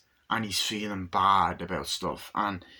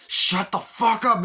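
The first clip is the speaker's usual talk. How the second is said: loud, higher, slower furious yell; angry shout; 12 semitones higher, 7 dB louder, slower than usual. Retold or imitated. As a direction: blow up at him. Kids quarrel over father.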